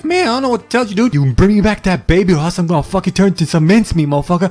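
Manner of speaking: high, ranting cat character; mock angry demand. Little kitty demanding the baby back, mock angry. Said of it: "Little kitty's like".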